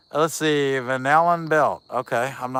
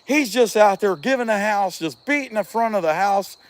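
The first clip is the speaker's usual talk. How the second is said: ordinary talk, nothing marked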